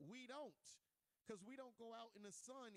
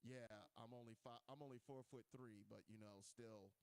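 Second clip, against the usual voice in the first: much lower, softer mock threat; bassy little tough guy; deep tough voice; 10 semitones lower, 4 dB quieter than usual. Playful threat to a nervous guy in a deep voice. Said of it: "throw it a little bit extra deeper".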